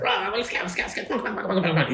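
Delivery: high, rapid-repeating goblin chivvying; hurrying goblin. Acted. Goblin pushing the halfling off, high hurried urging. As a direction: shoo someone along in a high goblin voice.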